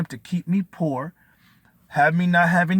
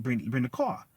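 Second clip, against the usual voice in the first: normal talking voice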